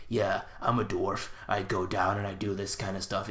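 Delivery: imitating a giant dwarf's dark, gritty voice, slowed down. Film reviewer mimicking the giant dwarf's voice, dark and gritty. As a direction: do a mock gritty dwarf impression. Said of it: "kind of dark, gritty voice".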